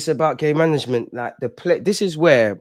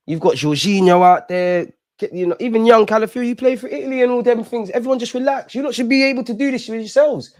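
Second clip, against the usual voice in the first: high pleading; 9 semitones higher than usual. Real, not acted. Fan pleading with team on screen.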